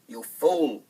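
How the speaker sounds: loud, contemptuous snap; scornful stepmother. Acted. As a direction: scold him sharply. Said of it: "cried the stepmother".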